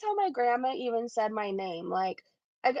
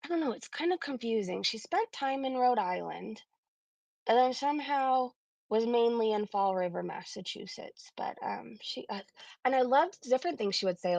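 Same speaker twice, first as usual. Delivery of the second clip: ordinary talk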